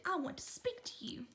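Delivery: hushed and menacing; mother warns her son in a hushed whisper; stern whisper. Acted. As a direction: whisper it like a warning.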